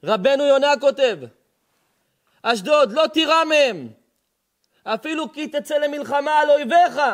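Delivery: high, loud sermon cry; preaching at full force. Acted. Rabbi proclaims the verse loudly to the congregation. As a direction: thunder the promise to the crowd.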